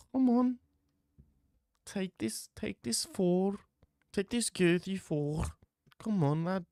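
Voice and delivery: hammed-up high Scouse coaxing; mock Molly McCann.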